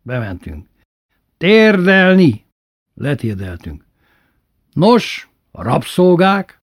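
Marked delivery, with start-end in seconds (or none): 1.4-2.4 long, drawn-out loud order; tyrant's drawn-out roar; shouted command. A narrator's reading. Brother shouts orders at slaves. 4.7-6.6 loud, high, drawn-out questioning call; haughty master's prompting shout; imperious demand. A narrator's reading. Brother lords it over slaves.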